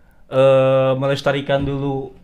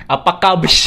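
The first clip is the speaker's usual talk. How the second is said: imitating a YouTuber catchphrase; 3 semitones higher, 4 dB louder, slower than usual. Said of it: "YouTuber nasional kan bilang kan".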